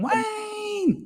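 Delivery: very high yelled "Wayne!"; war cry. Pretend. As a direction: yell the name like a battle cry. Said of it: "Sounds like a war cry here".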